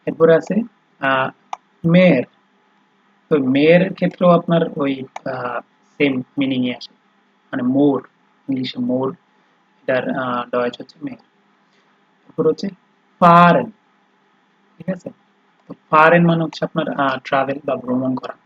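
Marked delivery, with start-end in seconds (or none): 1.8-2.2 মেয়ের said out loud and clear for the class; careful demo word. Shown as a demonstration. Teacher modelling মেয়ের for students, loud and clear. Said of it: "ভাওয়েলটা একটু টেনে পড়তে হয়". 13.2-13.7 ফারেন said loud with a held first vowel; careful demo word. Shown as a demonstration. Teacher modelling ফারেন for students, loud and clear. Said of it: "ভাওয়েলটা একটু টেনে পড়তে হয়".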